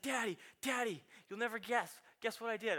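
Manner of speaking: put-on high, eager toddler voice calling daddy; small boy bursting to tell his dad; excited little boy. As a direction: bounce it like a three-year-old with news. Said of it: "He's like".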